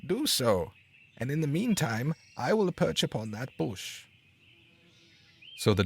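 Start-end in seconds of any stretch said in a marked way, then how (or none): 0.1-4.1 sparrow. A narrator's reading. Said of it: "answered the sparrow".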